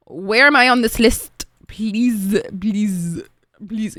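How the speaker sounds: drawn-out mock plea, fading; hammed-up begging that tails off; mock pleading. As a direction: plead playfully, starting loud and stretched. Acts out pleading over a wait list.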